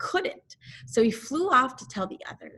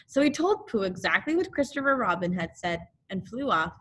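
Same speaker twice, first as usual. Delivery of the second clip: ordinary delivery, nothing marked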